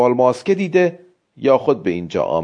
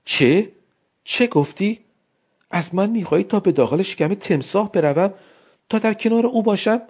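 higher, incredulous exclamation; wife aghast at the crocodile idea, higher; astonished cry; 7 semitones higher than usual. Acted. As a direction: rise high in disbelief. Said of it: "فریادی از حیرت کشید".

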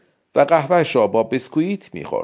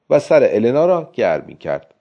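usual speaking voice

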